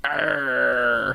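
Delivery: low, drawn-out pirate "arr"; mock pirate growl. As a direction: answer with a long pirate growl. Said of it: "do you know what a pirate's favorite letter is?".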